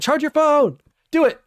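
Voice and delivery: high, loud urgent yell; shouting. Real, not acted. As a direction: yell the order at the viewer. Yelling at chatter's low battery.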